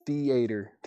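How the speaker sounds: "Theater" drawn out in three hard syllables, thee-AY-ter; Chad's three-syllable theater. Retold or imitated. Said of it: "Three hard syllables on that one".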